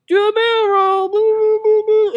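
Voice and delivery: put-on very high, loud childish voice; kid Anakin voice. Young Anakin blasting ships, squeaky high kid voice. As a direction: squeal it like a small kid playing pilot. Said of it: "He's like".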